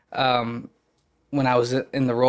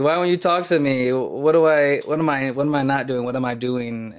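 acted pleading; 2 semitones higher, 3 dB louder than usual. Actor voices the need to be liked, mock pleading. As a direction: plead to be liked, starting raised and settling back.

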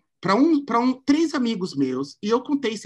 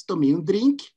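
usual speaking voice

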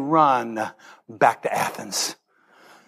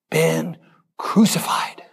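whispered; slower than usual. Acted. Quoting Paul, hushed.

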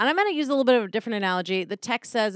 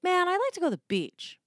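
wistful; 9 semitones higher, faster than usual. Retold or imitated. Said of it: "sometimes you're like".

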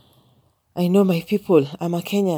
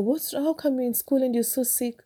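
higher, puzzled teacher asking; concerned question; 9 semitones higher than usual. Retold or imitated. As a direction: ask the sick child in disbelief. Teacher questioning sick child.

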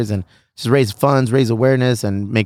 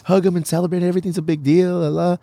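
imitating wife; 7 semitones higher than usual. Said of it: "wifey told me".

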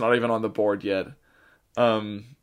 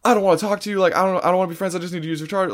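mild mock yell, pitched higher; retold screaming; 7 semitones higher, much faster than usual. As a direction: imitate an upset stranger's outburst without real volume. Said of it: "screaming at me".

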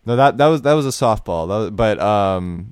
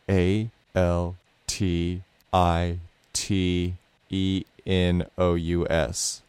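slow, letter-by-letter spelling; slower than usual. Shown as a demonstration. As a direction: spell each letter slowly.

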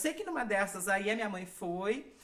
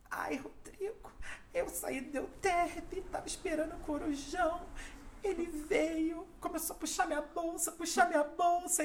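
whispery, higher, slowed plea of a shaken mother; 6 semitones higher, 5 dB quieter than usual. Retold or imitated. Mother telling her son Rodrigo about the robbery, mock distressed. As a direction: breathe it out small and pleading, higher and softer.

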